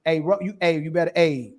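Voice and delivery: ordinary delivery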